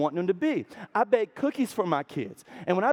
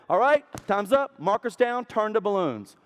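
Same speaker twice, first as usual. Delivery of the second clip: brisk raised call to the class; teacher calling out; 4 semitones higher than usual. Retold or imitated. Teacher to fifth graders, a bright raised call. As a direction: call time like a game host.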